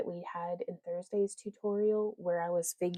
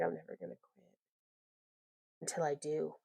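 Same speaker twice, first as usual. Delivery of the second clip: dropped, much softer, half to herself; softer mock-serious vow; mock resolve; 2 semitones lower, 10 dB quieter than usual. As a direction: mutter the vow with a wry undercut. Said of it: "And then it's like".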